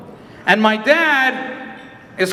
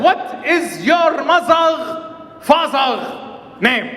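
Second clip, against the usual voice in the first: officer demand; 4 semitones higher, much slower than usual. Retold or imitated. Officer escalating question, shouted.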